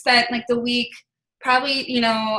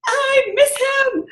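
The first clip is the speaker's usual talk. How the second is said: slow, high, tearful plea; retold sobbing; 4 dB louder, much slower than usual. Grieving her dad before the family weekend, a slow high sob. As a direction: let the grief spill out slowly on each word. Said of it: "And then I just lost it".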